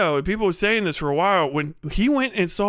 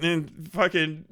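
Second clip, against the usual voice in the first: ordinary talk, nothing marked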